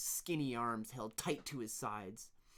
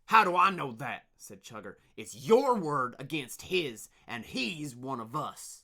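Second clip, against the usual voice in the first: angry; 3 semitones higher, 12 dB louder than usual. Acted. Leader dismisses.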